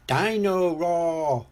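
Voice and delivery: high, slow piglet voice; George. A narrator's reading. George plays with his toy in the bath, drawn-out and high. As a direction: stretch the toy's name out high. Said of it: "says George".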